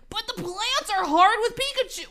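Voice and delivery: hammed-up complaint from a story hero; protesting young hero. Hero objects to the voice.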